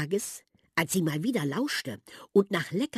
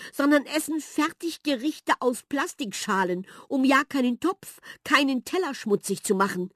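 high, indignant mouse voice; a hungry mouse airing her grievances; high mouse voice, speeding up in complaint; complaining mouse; 7 semitones higher than usual. A narrator's reading. Miller complains to the court.

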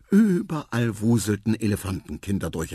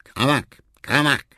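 higher, loud bird clatter; clattering marabou; 5 semitones higher, 7 dB louder than usual. Acted. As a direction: rattle it out like a stork's beak. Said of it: "klapperte der alte Marabu". (klappernder Marabu-Ruf)

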